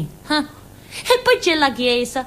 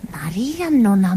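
whisper; 3 semitones lower than usual. Acted. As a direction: confide it like a secret, breathless. Secret confided about Maria. (sussurrato lento, come un segreto)

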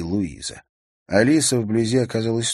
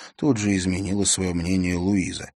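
usual speaking voice

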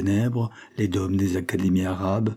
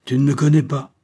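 higher and louder, fierce; savage tone; 6 semitones higher, 5 dB louder than usual. A narrator's reading. Gilles confronting his father's killer, savage. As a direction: throw it at him savagely. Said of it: "avec un accent sauvage".

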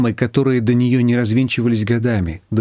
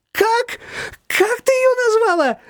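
very high, slow, thrilled question; delighted surprise; slower than usual. A narrator's reading. Shushana thrilled by Nina's nickname. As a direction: squeal with delight.

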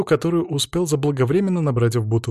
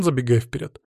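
ordinary delivery

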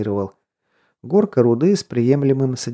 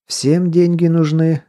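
slow, higher, louder musing; philosophizing; 6 semitones higher, 5 dB louder, slower than usual. A narrator's reading. Серёга answers the man who needs money, philosophizing. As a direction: hold it out like a grand general truth. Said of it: "снова стал философствовать Серёга".

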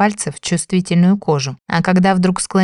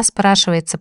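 ordinary delivery

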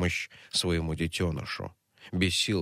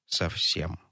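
ordinary delivery

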